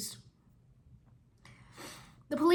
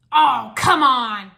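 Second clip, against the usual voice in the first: loud, slow drawn-out groan of complaint; complaining; 13 dB louder, much slower than usual. Acted. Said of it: "the mechanic complained".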